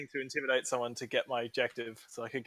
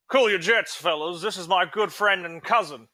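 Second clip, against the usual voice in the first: bluffing cover story; 5 semitones higher, 5 dB louder, slower than usual. Acted. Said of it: "I'm gonna be like".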